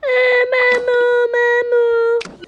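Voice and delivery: high, slow drawn-out fake wail; hammed-up crocodile tears calling maman; fake crying for mum. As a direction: pretend to cry so mum takes your side. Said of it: "je suis trop forte en comédie".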